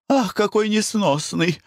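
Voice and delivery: high-pitched annoyed outburst; exasperated exclamation. A narrator's reading. Wife at her unbearable husband, exasperated exclamation. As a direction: cry out, fed up with him. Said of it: "воскликнула Глафира Семёновна".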